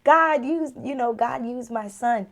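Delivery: a sudden rush of amazement; awed realization. Retold or imitated. Mother realizing God spoke through her son, amazed exclamation. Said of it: "I was like".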